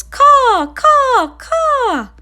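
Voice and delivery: loud, high crow cawing; storyteller showing kids a crow's caw. Shown as a demonstration. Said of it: "what sound a crow makes".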